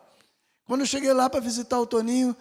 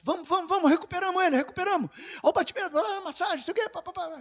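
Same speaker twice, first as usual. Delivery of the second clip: high, hurried urgency; urgent doctors; 10 semitones higher, faster than usual. Retold or imitated. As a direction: mimic the frantic medical team. Doctors reviving the patient.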